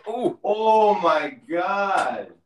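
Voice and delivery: drawn-out, high shocked gasp. Real, not acted. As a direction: stretch it out in disbelief. Reacting to minus thirty-five wind chill.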